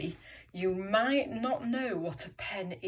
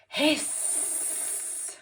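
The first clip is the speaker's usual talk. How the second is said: long, voiceless hiss; expressive goose hiss; 6 semitones higher than usual. Shown as a demonstration. Teacher leading children in animal sounds, long goose hiss. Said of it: "say this with loads of expression".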